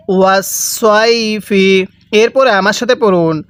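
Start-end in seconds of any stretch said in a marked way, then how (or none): 0.1-1.6 slow ওয়াস সই ফি with a stretched সই; read-along recitation. Shown as a demonstration. Teacher leads learners reading aloud, slow measured ওয়াস সই ফি. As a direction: hold সই, then land evenly on ফি.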